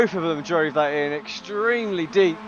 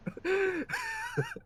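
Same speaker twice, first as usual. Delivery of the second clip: laughing; 9 dB quieter than usual. Real, not acted. Co-host laughs after.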